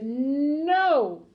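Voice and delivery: long, drawn-out no, moderately loud; firm refusal. A narrator's reading. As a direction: refuse firmly, stretching the word. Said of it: "The father said".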